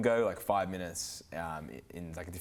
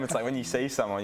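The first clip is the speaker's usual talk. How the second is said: ordinary talk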